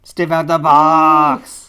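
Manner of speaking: very loud, drawn-out holler; slow, booming mock proclamation; mock announcer yell. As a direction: stretch every word into a big shout. Mock announcer call on the toy.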